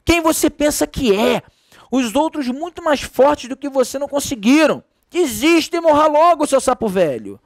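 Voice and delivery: mock jeering. A narrator's reading. Narrator voicing the angry crowd at the frog, mock jeering. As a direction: taunt him like a crowd, lightly. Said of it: "e gritavam".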